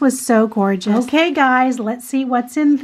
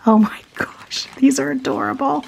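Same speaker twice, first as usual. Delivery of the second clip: giggly whisper. Real, not acted. Awed unboxing, whispered.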